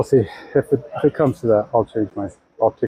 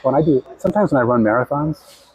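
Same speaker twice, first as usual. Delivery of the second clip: usual speaking voice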